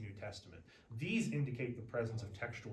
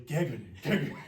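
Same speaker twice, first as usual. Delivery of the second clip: giggling through the word Geganen, louder each time; laughing at own silliness; 7 dB louder, faster than usual. Real, not acted. Teacher cracks up repeating Geganen, laughing. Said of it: "I sound so silly".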